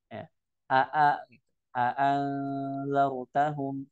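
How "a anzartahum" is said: "A anzartahum" slow, both hamzas fully pronounced; full tahqiq. Shown as a demonstration. Teacher demonstrating tahqiq to students, slow and clear. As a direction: sound both "a"s of "A anzartahum" separately, no smoothing. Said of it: "harus dibaca dengan tahqiq".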